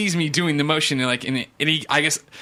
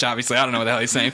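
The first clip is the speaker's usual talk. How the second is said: normal talking voice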